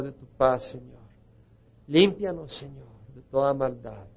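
earnest spoken prayer to the Lord; pastor's fervent closing prayer. Acted. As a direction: pray it over the congregation.

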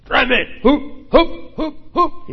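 high, loud rhythmic calls to rowers; coxswain's high barked count; rowing command shout. Shown as a demonstration. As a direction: shout the stroke in steady beats. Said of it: "los está guiando con voz de mando".

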